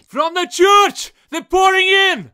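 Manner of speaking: high loud warning; loud battle callout; alarmed shout. Acted. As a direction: yell that enemies are coming.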